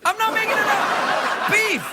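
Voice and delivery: comic incredulous squeal; high-pitched insistence. Acted. Host swears to audience it is true, high excited insistence.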